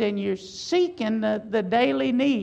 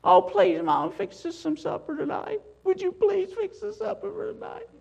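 a lightly voiced whiny child pleading, higher and softer; begging child; 7 semitones higher, 3 dB quieter than usual. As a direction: mimic a kid begging mom for supper, higher and softer. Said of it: "begging you for supper".

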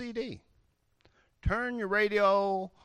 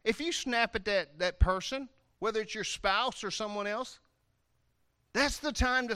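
ordinary talk, nothing marked

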